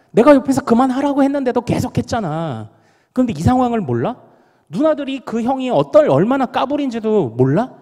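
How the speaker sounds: put-on high, loud protest of a wronged boy; retold bitter indignation. Boy protesting his sister's friends' misjudgement, bitterly indignant. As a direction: act out an aggrieved kid arguing back, pitch climbing. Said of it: "더 막 악에 받쳐가지고요".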